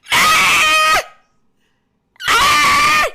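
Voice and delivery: very high loud straining scream; strained toilet yell. Retold or imitated.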